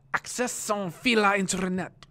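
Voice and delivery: "Accès sans fil à internet" said in French, high; French phrase. Shown as a demonstration. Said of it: "I'm gonna say the word".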